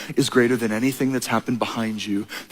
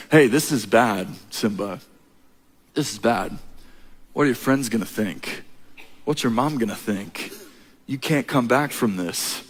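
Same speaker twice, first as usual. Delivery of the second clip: lower, softer sly villain voice; 4 semitones lower, 5 dB quieter than usual. Retold or imitated. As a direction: croon it low like a scheming uncle. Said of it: "an enemy swoops into the gorge ... and says".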